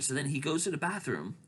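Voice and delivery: usual speaking voice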